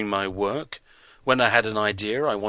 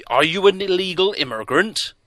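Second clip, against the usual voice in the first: loud, higher accusing question; 10 semitones higher, 7 dB louder than usual. A narrator's reading. Doctor pressing the stranger, loud accusing question. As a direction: press him hard with the question.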